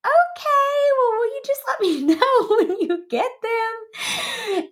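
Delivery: very high, sing-song sweet request; forced politeness. Retold or imitated. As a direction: squeak it out, over-nice and desperate. Retold forced-polite reply to coordinator.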